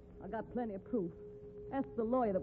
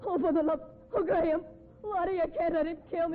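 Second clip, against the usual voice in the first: frantic pleading; 6 semitones higher, 3 dB louder than usual. Acted. Begging for her life.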